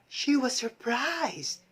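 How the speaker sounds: slow, gasping surprise; surprised. Shown as a demonstration. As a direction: catch the breath in surprise. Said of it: "emotion of surprise".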